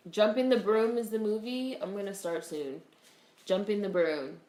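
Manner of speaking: normal talking voice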